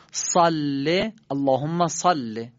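"صله" with a thick, throaty S, drawn out slowly; slow recitation of "صله" with an emphatic Arabic S; heavy Arabic sad. Shown as a demonstration. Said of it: "صاد رو به همون حالت خودش صاد بگیم".

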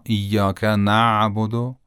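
"نعبد" with a long, soft ayn after نَ; correct soft ayn. Shown as a demonstration. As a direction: stretch the throat sound in "نعبد" without a break. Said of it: "این صحیح و درسته". («نعبد» با عین نرم و کشیده)

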